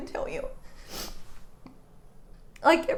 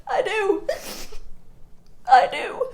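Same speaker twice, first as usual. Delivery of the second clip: defiant; 7 semitones higher, 4 dB louder, slower than usual. Retold or imitated. Defiant answer, second louder.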